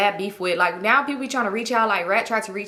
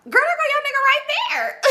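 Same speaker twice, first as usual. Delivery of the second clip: very high squeal breaking into laughter; gleeful shriek. Retold or imitated. Pointing out her man with girls in the parking lot, gleeful laughing shriek. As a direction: shriek it, then crack up.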